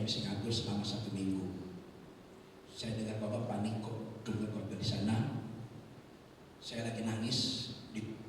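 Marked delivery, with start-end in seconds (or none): none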